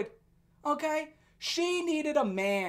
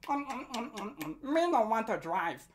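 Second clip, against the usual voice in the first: mock dithering husband; 2 semitones lower than usual. Mocking husband's hesitant reply.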